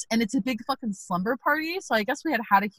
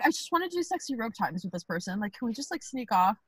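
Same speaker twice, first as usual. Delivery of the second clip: much softer, quick hushed plea; 3 semitones lower, 8 dB quieter, faster than usual. Retold or imitated. In a shared bed, hushes so nobody wakes. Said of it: "I'm like".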